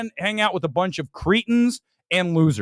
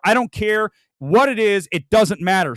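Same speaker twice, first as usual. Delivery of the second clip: ordinary talk, nothing marked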